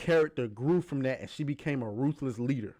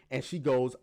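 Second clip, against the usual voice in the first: ordinary delivery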